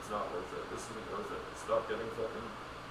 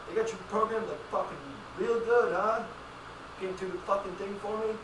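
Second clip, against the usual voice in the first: much higher, louder sarcastic yelling; mock yelling at AI; 10 semitones higher, 4 dB louder than usual. Customer yelling at a disobedient AI, high and loud. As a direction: yell sarcastically at a stubborn robot. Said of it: "you're like".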